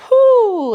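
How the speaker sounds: high loud whoo of exertion; effort whoop. Real, not acted. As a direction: cry out whoo through the strain. Instructor exhales mid-workout.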